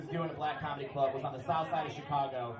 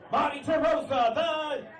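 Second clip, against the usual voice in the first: higher, slow hype shout; announcer shout; 8 semitones higher, 5 dB louder, slower than usual. Pretend. Comic tries a stolen nickname, hype shout. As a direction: call it out like an MC.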